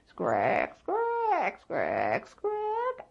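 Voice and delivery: put-on see-saw squeak of an old rocking chair; rocking chair creak. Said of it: "kept on a-rockin'".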